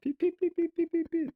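high, slow squeaky peeps; peeping baby chick. Pretend. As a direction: peep each one high and slow like a chick. Voicing tiny creatures.

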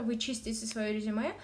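ordinary talk

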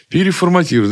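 high, loud, keen repeat; eager exclamation. Retold or imitated. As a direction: say it like a happy novice. Retelling his own naive click.

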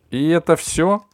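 chilling, sharp question; icy voice. A narrator's reading. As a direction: freeze him with it. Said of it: "леденящим душу голосом".

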